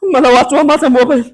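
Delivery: high, loud cry of distress; hammed-up desperate lament; panicked wail. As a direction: wail for help as if dying. Panicked over his lost phone.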